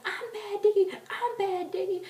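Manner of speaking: very high, eager squeal; fangirl calling out. Retold or imitated. Fan answering [name] from the crowd, squealing. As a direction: squeal it up to the stage.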